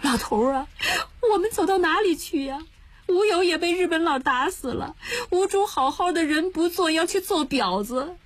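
high, tearful wailing complaint; crying lament. Acted. Old woman follows husband into the rain, lamenting. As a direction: cry it out in the rain. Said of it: "哭着说".

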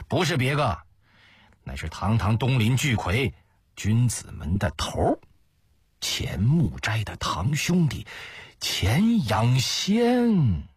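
gloating reveal, stretching out the final name; malicious, smug. Acted. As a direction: savour the name, drawing it out slowly. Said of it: "用恶毒得意的声调说".

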